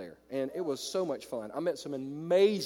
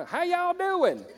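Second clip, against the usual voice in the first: slow, much higher Southern drawl on "How y'all doing"; Southern greeting; 5 dB louder, much slower than usual. Pretend. Pastor shows the church how Southerners greet, in a slow Southern drawl. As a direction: stretch it out like a Southern host. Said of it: "in the South, people get me".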